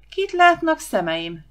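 high, loud drawn-out cry; loud exclamation. Acted. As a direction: whoop in theatrical astonishment. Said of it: "rikkantotta Huba".

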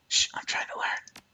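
hushed whisper telling someone to be quiet; unvoiced classroom shush. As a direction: breathe it out, shushing the talker beside you.

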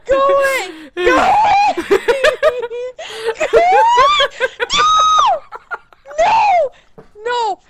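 high, loud shrieks breaking into laughter; panicked screaming. Real, not acted. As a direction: shriek to drive off your pursuer. Fleeing the killer in game.

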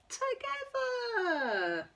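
high, slow, tender stretch; drawn-out together. Acted. Tender story ending.

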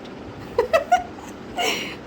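high airy laugh; breathless laugh. Real, not acted. Laughs at DM joke. (মজার কথায় উঁচু গলায় হাসি)

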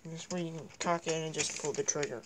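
ordinary talk, nothing marked

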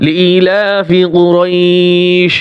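slow, chanted tajweed recitation; Quran recitation. Acted. Teacher reciting Surah Quraish as an example. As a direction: recite it slowly with a long stretch.

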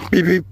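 robotic alarm chirp; mock alarm beeps. Imitating second alarm.

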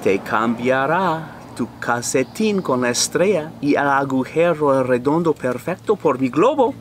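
usual speaking voice

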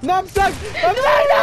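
loud high trapped panic; panicking gamer. Real, not acted. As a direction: yell in panic, cornered. Trapped as enemies close in.

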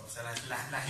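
usual speaking voice

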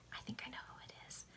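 very soft, quick whispered aside. Real, not acted. Reader slips in a guess about the story, whispered. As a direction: whisper it fast, barely any volume.

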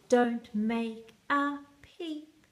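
plain reading voice

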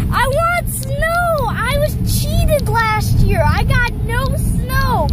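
high, drawn-out indignant wail; whiny snow tantrum. Real, not acted. Vlogger cheated of snow last year, high whiny complaint.

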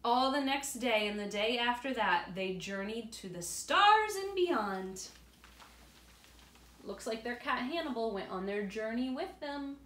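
put-on reading aloud to children; story narration. A narrator's reading. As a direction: tell it warmly to little ones.

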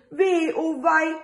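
lightly voiced sad mother hen sighing. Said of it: "die Frau Huhn sagt traurig".

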